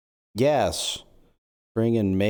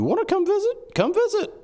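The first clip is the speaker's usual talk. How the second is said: much higher calling-out voice; sing-song call. Real, not acted. High sing-song call to whoever might come visit. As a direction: call out to passers-by, a bit fast.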